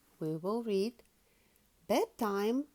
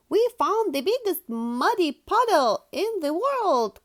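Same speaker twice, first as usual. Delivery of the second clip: high, louder boast; bursting with pride; excited cry; 9 semitones higher, 7 dB louder than usual. Acted. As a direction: Peppa boasting to Daddy about the puddle, high and loud. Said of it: "cries Peppa".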